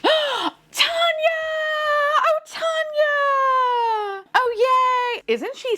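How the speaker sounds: gasp, then very high, drawn-out squeal; delighted high-pitched cheering; overjoyed squeal. Real, not acted. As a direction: cry the name out high and stretched. Pulls the toy she adores.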